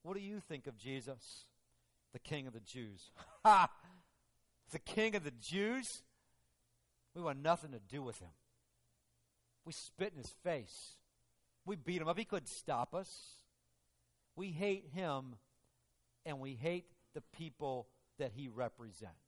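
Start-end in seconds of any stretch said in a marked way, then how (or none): 3.4-3.7 loud mocking burst of a laugh; scornful laugh. Soldier on witness stand scoffing, loud mocking laugh. 4.7-19.2 a hint of a sneering soldier; lightly voiced mocking Roman soldier; scornful soldier. Soldier on witness stand rejecting Jesus, scornful.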